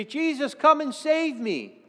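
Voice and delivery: high, loud, slow earnest plea; slow, lifted pleading prayer; sample prayer. Shown as a demonstration. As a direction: show them how to call on his name. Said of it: "All you have to do is say".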